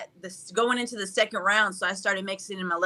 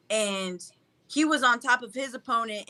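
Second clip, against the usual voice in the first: ordinary delivery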